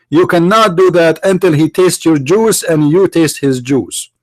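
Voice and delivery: normal talking voice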